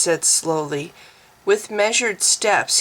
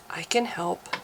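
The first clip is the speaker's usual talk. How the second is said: much softer, breathy; a trembling woman offering help softly; breathed softly; 9 dB quieter than usual. Acted. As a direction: barely voice it. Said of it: "she breathed softly".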